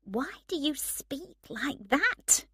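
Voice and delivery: high, drawn-out incredulous question; disapproving challenge. A narrator's reading. Emma questions him once the couple has gone, disapproving. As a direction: challenge him on his silly voice.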